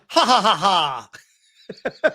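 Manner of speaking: slow, drawn-out imitated villain cackle; hammed-up anime villain laugh, slow; evil villain laugh. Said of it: "it's like the evil villain laugh from, like, an anime".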